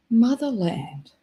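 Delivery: slow, mock-solemn Motherland; mock grand. To her stream, a mock-reverent slow Motherland. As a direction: savour Motherland slowly, half-joking.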